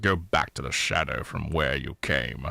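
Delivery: deep, slow English wizard; Gandalf. Shown as a demonstration. Quoting Lord of the Rings for co-hosts, grave wizard impression. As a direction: intone it low and slow, banishing a foe. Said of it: "But Ian McKellen plays, uh, Gandalf".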